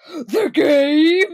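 loud, high and laughing, a stunned crowd; mock crowd gasp. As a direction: ham up a crowd that can't believe it. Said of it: "And everyone goes".

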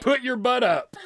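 high, loud insistence; loud pushy demand; shouted command. Real, not acted. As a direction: yell the order over everyone. Repeating the command loudly.

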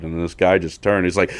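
ordinary delivery

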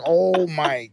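drawn-out slow gasp of awe; slow amazed oh my god. Retold or imitated. Offered a Benz by Mike, slow awed oh my God. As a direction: stretch it out, dazzled. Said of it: "I was like".

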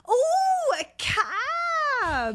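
exaggerated ooh, stretched out in mock awe; sister's teasing. Sister teasing speaker for saying cab, high mock awe. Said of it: "And she went".

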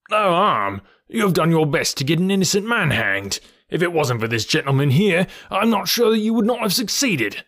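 high, outraged scolding; indignant, heated reproach; furious detective. A narrator's reading. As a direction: throw the words back, pitch climbing. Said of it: "furious red face of the angry detective".

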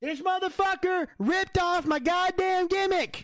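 high, slow indignant rant; pissed off. Real, not acted. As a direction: stretch each word in outraged protest. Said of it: "I'm too pissed off".

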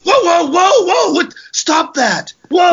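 high alarmed whoa, whoa; startled yelling. Retold or imitated. Girl in parking lot startled by stranger, high yelp. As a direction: yelp it like someone jumping back.

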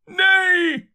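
very high, loud cry of refusal; full-voiced shout. A narrator's reading. Curate refusing to be silenced, top-of-the-voice shout. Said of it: "shouted the curate at the top of his voice".

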